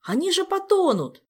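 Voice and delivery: high, loud alarmed protest; frightened cry. A narrator's reading. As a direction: cry out in panic for their safety. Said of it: "испуганный вскрик Жака".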